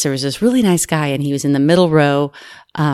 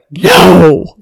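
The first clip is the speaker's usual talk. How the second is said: loud, drawn-out disbelief; scandalized disbelief; 8 dB louder than usual. Retold or imitated. Flight attendant reacting to lurid gossip, scandalized disbelief. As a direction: re-enact being utterly scandalized.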